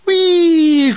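high, loud falling shell whistle; mock shell whistle. Imitating incoming shell.

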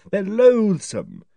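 loathing drawn out slowly and loudly; angry. Acted. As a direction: spit out the word in disgust. Narrator's angry lecture to Megan.

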